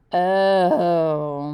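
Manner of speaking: a loud, pained groaning oh; dad's loud groan at his son's worry. Acted. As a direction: groan it out like a defeated dad. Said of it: "my dad groaned".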